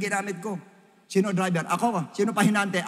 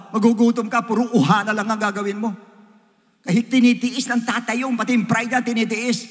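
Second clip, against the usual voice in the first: raised, higher, louder preacher's plea; preaching; 6 semitones higher, 6 dB louder than usual. Acted. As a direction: press the point on the congregation. Preaching about fathers' sacrifices.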